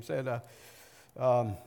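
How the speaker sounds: normal talking voice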